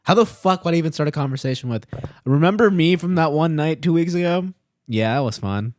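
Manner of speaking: fast, loud, high exasperation; exasperated rant. Retold or imitated. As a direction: rattle it off in disbelief. Exasperated imagined text.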